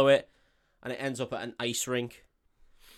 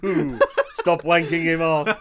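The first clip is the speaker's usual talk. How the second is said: mock stern shout; 4 semitones higher, 9 dB louder, slower than usual. Mock giant hand telling off.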